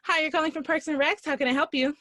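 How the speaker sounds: high, loud phone greeting; customer service voice. Shown as a demonstration. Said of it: "That's my customer service voice".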